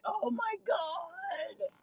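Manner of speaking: drawn-out, high-pitched exasperation; exasperated. Retold or imitated. Listener reacting to people agreeing with random names, exasperated. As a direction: stretch it out high in mock despair.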